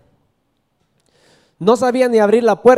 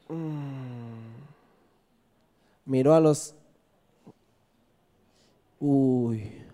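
normal talking voice

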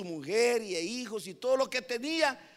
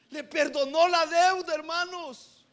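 "Le perdonó la deuda" much higher and louder; exultant shout of grace; triumphant proclaim; 8 semitones higher, 5 dB louder than usual. Acted. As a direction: proclaim it like good news. Preacher repeats it with triumph.

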